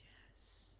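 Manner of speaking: hushed, whispered yes; faint dying reply. Retold or imitated. Dying mother answers the speaker's question, faint whisper. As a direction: imitate a dying woman's weak whisper.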